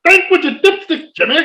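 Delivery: high hammed-up ad yell; commercial shout. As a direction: bellow the catchphrase like the TV spot. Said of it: "I bring up the old commercial".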